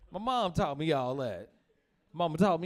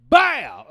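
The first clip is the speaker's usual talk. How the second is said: loud mock impact sound; a sharp loud bow for the kick; 5 semitones higher, 16 dB louder than usual. Imitating mom's kick with a loud bow. Mom kicking sound effect.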